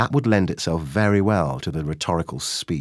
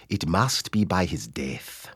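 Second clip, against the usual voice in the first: slow, deliberate East African accent; measured East African delivery, word by word; strong East African accent; 4 dB quieter, slower than usual. Shown as a demonstration. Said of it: "the strongest East African accent".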